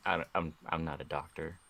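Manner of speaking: normal talking voice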